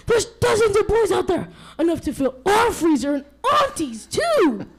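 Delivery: high, loud eager report; excited exclaiming. Acted. Little wolf telling dad about the boys, high excited exclaiming. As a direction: blurt out the news excitedly. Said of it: "he exclaimed".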